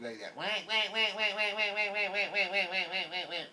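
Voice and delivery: high, loud whack whack quacking; mock duck quacks. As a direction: imitate a TV duck caller's quacks. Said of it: "like you see on T V".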